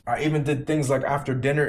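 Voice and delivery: ordinary delivery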